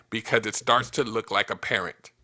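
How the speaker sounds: ordinary talk, nothing marked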